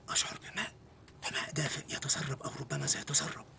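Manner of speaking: whispered worried confession; hushed anxious whisper. Acted. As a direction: whisper the embarrassing news. Hiding, must not be heard.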